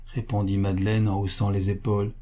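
plain reading voice

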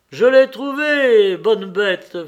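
loud, high, drawn-out call; excited praise. Acted. Hunter to dog who found the bird, exclaiming delightedly. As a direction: call out in triumph to your dog.

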